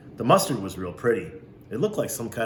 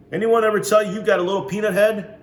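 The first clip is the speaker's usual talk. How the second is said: much higher, louder mock question; teasing; 11 semitones higher, 8 dB louder than usual. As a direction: poke fun at the kid's head. Man teasing the boy.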